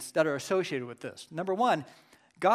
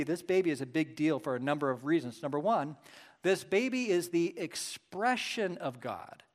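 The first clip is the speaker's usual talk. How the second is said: normal talking voice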